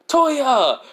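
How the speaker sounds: high, loud shout of the name; excited cry. Acted. Izuku spots his brother Toya, crying out high and loud. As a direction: call out on seeing him, voice leaping up.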